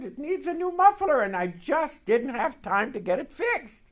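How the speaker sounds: lightly voiced brother making excuses; brother's voice. Brother's voice in retold call.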